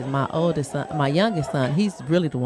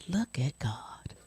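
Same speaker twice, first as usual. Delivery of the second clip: hushed awe; 2 semitones higher, 4 dB quieter than usual. Retold or imitated. Mother praising God over the news, hushed awe.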